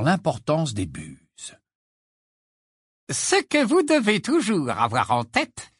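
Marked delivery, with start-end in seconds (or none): 3.1-5.7 high, squeaky professor voice; squeaking. Acted. Flitwick lectures the class, squeaky. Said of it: "couina le professeur Flitwick".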